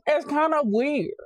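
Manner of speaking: high, loud, slow repeat. Shown as a demonstration. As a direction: belt it out slowly. Whispered then shouted.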